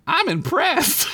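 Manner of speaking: slow, high, laughing mock awe; mock impressed. Squeak teases Derek, slow and laughing. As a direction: drag it out, laughing. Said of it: "let out an impressed whistle".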